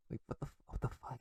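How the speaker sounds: hushed, voiceless what the fuck; whispered aside, half to himself. Real, not acted. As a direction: breathe it in disbelief. Aside in disbelief.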